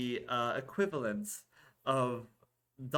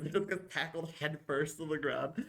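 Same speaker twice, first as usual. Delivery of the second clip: ordinary talk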